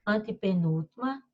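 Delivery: usual speaking voice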